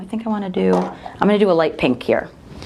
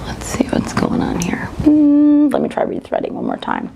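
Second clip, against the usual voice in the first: unvoiced whisper to herself; 7 semitones higher than usual. Real, not acted. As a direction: whisper while fiddling with the machine. Fixing thread, talking to self.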